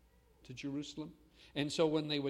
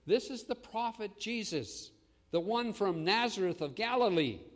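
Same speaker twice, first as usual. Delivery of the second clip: crowd shouting; 6 semitones higher, 6 dB louder than usual. Retold or imitated. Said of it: "the crowd shouted to them".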